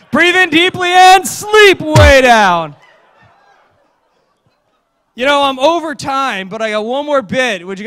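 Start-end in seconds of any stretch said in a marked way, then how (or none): 0.1-2.8 laughing sleep command. Acted.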